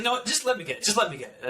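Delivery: high-pitched imitation of an impatient nurse; put-on high, dismissive voice; exasperated nurse. Retold nurse impatiently taking over.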